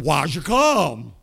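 loud, very slow drawn-out deacon question. Retold or imitated. Deacon quizzing the kneeling boy, slow and loud. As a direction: drag it out like a stern deacon.

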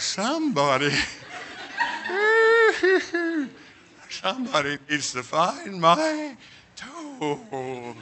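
slow, slurred sedated mumble; drugged drowsy plea. Retold or imitated. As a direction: let the drug pull the words down. They stick a needle in my arm.

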